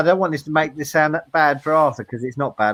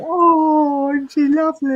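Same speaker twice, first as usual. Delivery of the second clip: high-pitched gushing coo; put-on adoring "aww" voice, pitched way up; mock cooing; 13 semitones higher, slower than usual. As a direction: coo in a much higher voice, as a hammed-up admiring chorus. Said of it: "you can all say".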